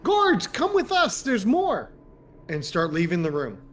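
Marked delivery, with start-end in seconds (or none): 0.0-1.9 high-pitched yell for the guards; yelling in character. Acted. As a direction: yell high and slow to summon help. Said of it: "I yell".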